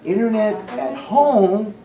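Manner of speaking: high, slow, earnest insistence; eager leaders all saying yes; voicing the leaders. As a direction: speak up for them, lifting each item. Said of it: "They all said".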